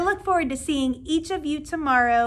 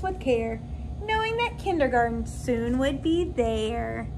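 lilting, sing-song storybook read; reading to children. Acted. Teacher reading a picture book to her new kindergarten class, lilting and gentle. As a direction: read it aloud like a bedtime story for little kids.

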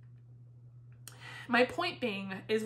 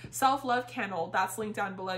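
ordinary talk, nothing marked

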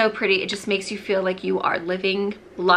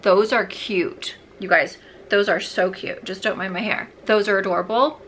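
ordinary talk

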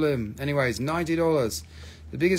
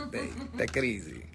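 usual speaking voice

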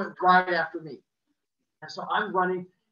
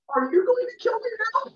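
put-on much higher, scared child voice; worried squeak; 6 dB louder than usual. Scared child to neighbour, high worried question. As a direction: ask it like a frightened kid.

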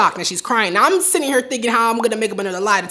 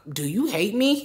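small, scared question; quiet; 6 dB quieter than usual. Retold or imitated. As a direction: ask it barely above a breath. Asking mom, scared.

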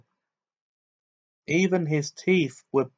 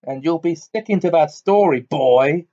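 forceful, louder angry warning; spitting threat; 4 semitones higher, 6 dB louder than usual. A narrator's reading. Uncle warning the boy to keep to the story, spitting. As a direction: spit the words out as a threat. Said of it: "spat Uncle Vernon".

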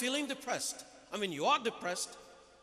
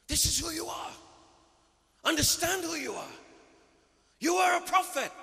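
higher, louder preaching crescendo; rising proclamation; 7 semitones higher, 4 dB louder than usual. Real, not acted. Preacher to congregation: soaring call, higher and louder. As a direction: build to a ringing declaration. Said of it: "preaching climax".

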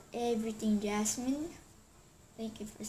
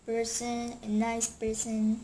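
usual speaking voice

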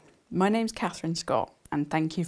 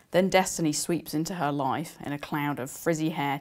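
ordinary delivery

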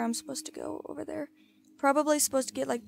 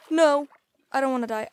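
loud panicked shout; yelling in real panic; panicking gamer; 9 dB louder, faster than usual. Real, not acted. Falling into water, panicking.

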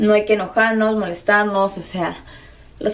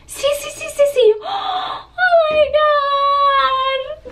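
rapid high yeses into a long shriek; acted ecstatic squeal; much slower than usual. Friends learn they share an event, re-enacted high ecstatic squealing. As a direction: squeal it, stretching the last words. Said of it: "hasta se los voy a actuar".

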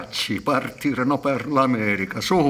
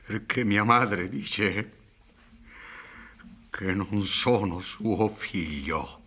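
pained confession; 4 semitones lower, 6 dB quieter, slower than usual. Acted. Painful confession, slowed.

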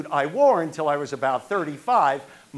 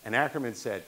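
ordinary talk, nothing marked

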